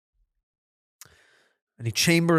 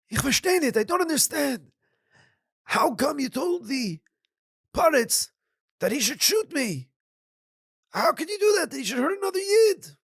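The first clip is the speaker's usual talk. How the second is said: higher, bewildered questioning; puzzled rabbi; 14 semitones higher than usual. Acted. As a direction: ask how he could do such a thing. Rabbi questions the wagon driver.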